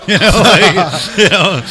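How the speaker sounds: high, loud, laughing words. Real, not acted. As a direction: talk through real laughter. Laughs while telling the story.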